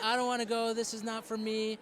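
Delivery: a light impression of a sulky student; whiny teen. Student refusing the program, mock whiny and high. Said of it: "Yeah, like".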